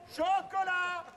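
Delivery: ordinary talk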